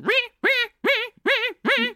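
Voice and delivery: high-pitched imitated violin stabs; repeated shrill "ree" squeals; screeching violins. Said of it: "violins in the background going".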